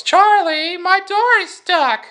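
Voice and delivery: very high, loud falsetto mom calling slowly; mother calling. Retold or imitated. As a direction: call up the stairs as a panicked mom. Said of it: "She goes".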